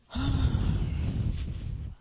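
long, forceful puff of air; blowing out candles. A narrator's reading. Olivia blows out her birthday candles, a big puff. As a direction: blow hard and long. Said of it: "and blew out the candles".

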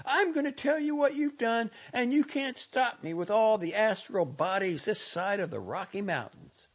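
high, loud defiant tirade; crying out angrily. A narrator's reading. Girl refusing to let the doctor stop her, furious. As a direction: override him, loud and high. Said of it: "she cried".

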